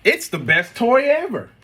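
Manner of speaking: put-on high, loud gleeful kid; delighted child. Spencer showing mom the box, high gleeful cheer. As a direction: gush like a thrilled little boy.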